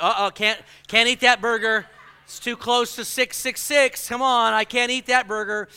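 loud, hammed-up fretting; mock panic. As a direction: fret loudly over a cursed burger. Friend panicking over number 666.

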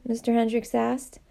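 ordinary delivery, nothing marked

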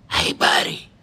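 battered parrot's voiceless croak; low breathy whisper of a beaten bird; weak whisper. Retold or imitated. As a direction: whisper it like you barely survived. Beaten parrot speaks weakly.

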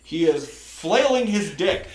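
playful drawn-out announcing; mock announcer. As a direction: draw it out like an excited play-by-play caller. Joins mock play-by-play.

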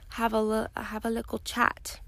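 brief British accent slip; British lilt sneaking in on a chat; slipped into British. Real, not acted. To her listeners, a passing British accent. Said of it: "I don't know why I turned British for a second".

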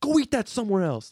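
high, exasperated scolding; annoyed nagging. Retold or imitated.

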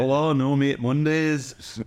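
put-on slow, drawn-out English accent; playful English regional voice; English accent impression. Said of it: "my English impressions".

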